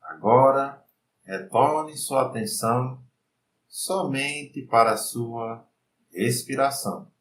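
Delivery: slow, soft meditation voice; guided meditation. Acted. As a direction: lead the mind calmly, unhurried.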